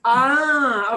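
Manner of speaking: ordinary talk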